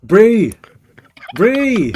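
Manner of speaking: high, loud drawn-out "bro" with a swooping pitch; mimicked loud "bro". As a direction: call out "bro" in mock Kiwi. Mimicking the clip's calls of bro.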